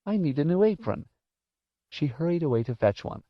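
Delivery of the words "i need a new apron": put-on high old-lady character voice; high-pitched old woman making an excuse; flustered old woman. A narrator's reading. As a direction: pitch it up as a fussing elderly woman.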